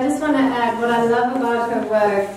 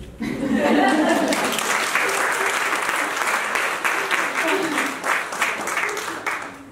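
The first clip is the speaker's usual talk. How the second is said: laugh; 5 semitones higher than usual. Real, not acted. Audience member laughs after speech.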